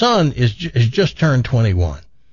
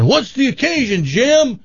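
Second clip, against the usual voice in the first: high-pitched call across the room; yelled question; 11 semitones higher than usual. Acted. Buddy to dad across the tavern, high called-out question. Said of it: "somebody yells out".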